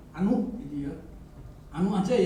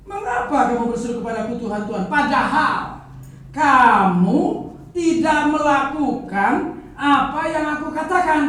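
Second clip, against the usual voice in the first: loud and quick, pressing each phrase; quicker, driving repeat of the rebuke; fast loud rereading; 6 semitones higher, 10 dB louder than usual. Acted. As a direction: rattle off Jesus' rebuke with mounting force. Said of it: "saya ulang ya, baca ya".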